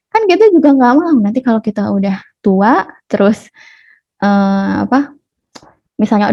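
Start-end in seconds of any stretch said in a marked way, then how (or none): none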